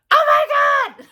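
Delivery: high, loud delighted shriek; excited shriek. Retold or imitated. Reliving seeing the stickers on the box, a high delighted shriek. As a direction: squeal it like you just saw the box. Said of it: "and I was like".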